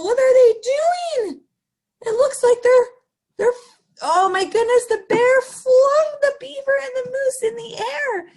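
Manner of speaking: high, loud astonished exclaiming; excited alarm. Real, not acted. Storyteller to kids, astonished at the flying friends. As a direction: gasp at the scene in disbelief. Excited narrator reacting to scene.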